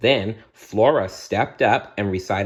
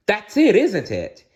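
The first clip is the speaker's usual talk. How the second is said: high, louder excited princess; excited guess; 12 semitones higher, 4 dB louder than usual. Acted. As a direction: burst out with the answer.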